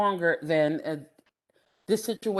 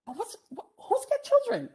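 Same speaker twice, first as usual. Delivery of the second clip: incredulous; 13 semitones higher than usual. Retold or imitated. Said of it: "I'm like".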